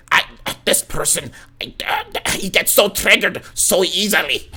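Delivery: slow, drawn-out mock outrage; mocking complainer. Streamer mimicking people who complain instead of leaving, mocking. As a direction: imitate a whiner getting worked up.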